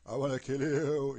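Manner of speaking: loud, drawn-out mock-menacing groan; mock evil donkey. Mocking the idea that a donkey sounds evil, loud evil donkey voice. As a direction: stretch it out like a hammed-up evil donkey. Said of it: "when he speak, he say".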